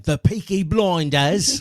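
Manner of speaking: slow, broad British accent; Peaky Blinders accent. Shown as a demonstration. Showing a friend one British type, Peaky Blinders accent. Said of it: "Like that type of British accent?".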